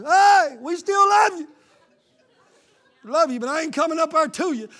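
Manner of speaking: high, loud cheery call from afar; mock church member. Members calling to a backslider from a distance. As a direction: wave and call from the sideline.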